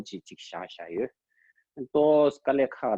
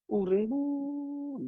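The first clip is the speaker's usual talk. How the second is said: "bu" held very long on a high, level note; drawn-out guidance; 13 semitones higher, much slower than usual. Shown as a demonstration. Teacher paces a student's long breath, drawn-out high instruction. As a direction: stretch "bu" out slowly, high and sustained.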